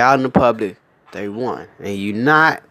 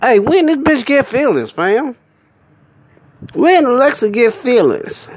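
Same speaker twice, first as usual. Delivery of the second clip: incredulous; 11 semitones higher than usual. Real, not acted. Incredulous high outburst.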